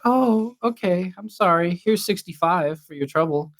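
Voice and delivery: high, timid pushover voice, imitating the co-host; mock meek apology. Teasing co-host as an awkward buyer who overpays, mock meek. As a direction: tease with a high-pitched, over-apologetic impression.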